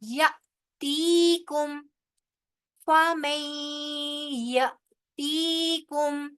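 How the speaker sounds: यतीकुम with the ti lengthened; slow recitation; tajweed recitation. Shown as a demonstration. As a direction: stretch the ti in यतीकुम. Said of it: "ती याए मद्दा है".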